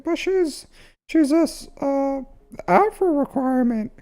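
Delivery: very high cartoon mouse squeak, stumbling on the words; teasing a viewer by replaying him in a mouse squeak; squeaky Mickey Mouse. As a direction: pitch it way up like a tiny cartoon mouse. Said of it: "why are you talking like Mickey Mouse".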